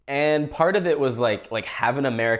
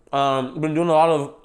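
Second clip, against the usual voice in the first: talking with a mouthful; words round a bite of food; mouth full; 2 semitones higher than usual. Real, not acted. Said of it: "why am I talking with my mouth full?".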